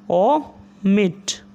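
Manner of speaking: Omit drawn out very slowly, syllables held long; slowly stretched dictation. Shown as a demonstration. Teacher dictating omit to shorthand students, very slowly stretched. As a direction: pull omit out slowly for the class.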